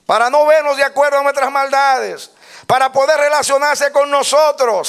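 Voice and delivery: predicar a plena voz, agudo y fuerte; prédica exaltada. Real, not acted. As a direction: proclama con fervor, alargando los vítores. (prédica a voz en cuello)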